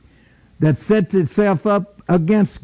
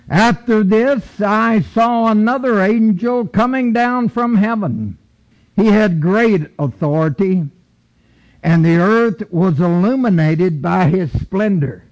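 scripture reading; 3 semitones higher than usual. Acted. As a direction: read it out like holy writ.

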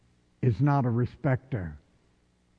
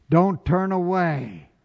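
ordinary talk

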